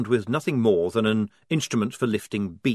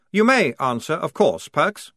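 a hint of a prim lieutenant; stiff, formal officer voice; lieutenant giving a private leave to speak; lightly voiced officer; 5 semitones higher, 4 dB louder than usual.

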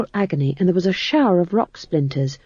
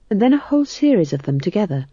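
ordinary delivery, nothing marked

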